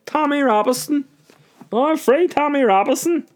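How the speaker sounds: very high, monotone falsetto slogan; mock idiot supporter. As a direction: squeal the slogan as a dim-witted supporter. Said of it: "that's the kind of idiots".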